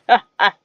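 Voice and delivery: loud mock villain cackle; loud hammed-up maniacal laugh. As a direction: cackle like a mad teacher. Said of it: "she just laughs a maniacal laugh".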